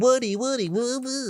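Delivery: mocking falsetto wail, held long; imitating him. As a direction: squeal it out in a silly high voice. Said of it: "He's like".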